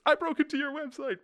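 very high, loud triumphant gloat; gloating squeal. Acted. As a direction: crow over the hack in a squeaky voice. Gloating after hacking a website.

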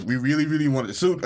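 normal talking voice

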